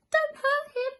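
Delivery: a small mouse pleading; tiny pleading mouse. A narrator's reading. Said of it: "a little voice said".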